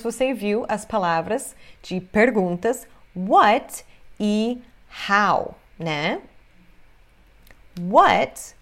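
ordinary delivery

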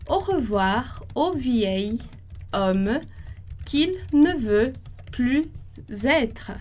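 slowly, word by word, with pauses; slow dictation. Shown as a demonstration. Teacher dictating the sentence slowly, pausing between words. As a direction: spell it out for the class slowly. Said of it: "slow dictation of the sentence".

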